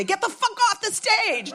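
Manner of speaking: imagining cutting off long eulogies at her own wake, mock yell. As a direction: blast it like a heckler kicking someone off.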